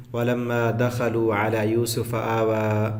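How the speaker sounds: slow Quranic recitation. Shown as a demonstration. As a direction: recite it slowly, stretching the vowels. Quran recitation before translation.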